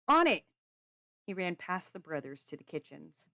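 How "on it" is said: high, loud quick reply; short, full-voiced yell; yelled back. A narrator's reading. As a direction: shout back that you're going. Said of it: "Crawford yelled back".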